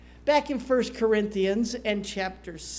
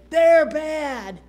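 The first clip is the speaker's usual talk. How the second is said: loud, high scornful judgement; raised, mocking verdict; mock condemnation; 5 semitones higher, 9 dB louder than usual. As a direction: sneer it out as the self-righteous crowd. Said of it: "and we say".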